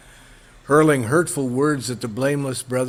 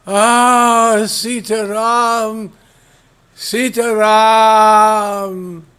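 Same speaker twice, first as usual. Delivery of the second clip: high, very slow, drawn-out cry of a dying creature; dying demon's cry; 6 semitones higher, 5 dB louder, much slower than usual. Acted. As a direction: stretch the names out like a last call for help. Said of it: "as the creature was dying, he said".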